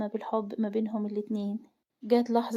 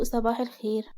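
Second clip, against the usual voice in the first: usual speaking voice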